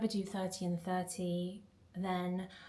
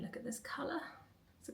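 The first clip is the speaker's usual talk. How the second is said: whispering; 6 semitones higher, 9 dB quieter, faster than usual. Real, not acted. Whispered aside on blush.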